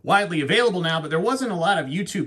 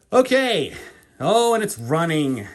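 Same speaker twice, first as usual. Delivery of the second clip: excited; 4 semitones higher, 6 dB louder than usual. Real, not acted. Watch revealed from box.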